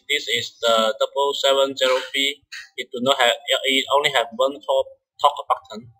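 ordinary delivery

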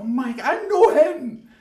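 loud, slow delighted shout; excited recognition. Retold or imitated. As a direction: call out like you spot a friend. Friends react to ape photo.

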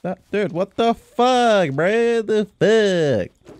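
high, loud outraged shout; stunned, drawn-out disbelief; shocked yell. Real, not acted. Suddenly attacked again.

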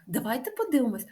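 normal talking voice

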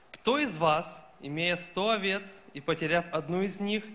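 usual speaking voice

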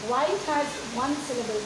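ordinary delivery

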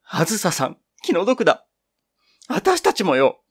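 high, loud tearful cry. Acted. Two girls clasp hands and weep for Azusa, loud tearful cry. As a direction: burst out in tears, voice cracking high. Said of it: "激しく泣き出した".

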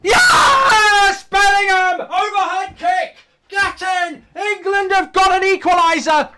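loud, high celebratory yelling; ecstatic shout. Real, not acted. Fan celebrating Bellingham's overhead kick, ecstatic shout. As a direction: scream with joy at the equaliser.

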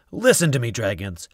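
usual speaking voice

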